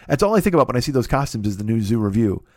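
usual speaking voice

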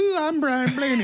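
mock high voice. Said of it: "walking around like".

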